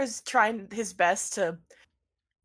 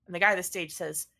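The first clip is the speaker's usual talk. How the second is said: ordinary talk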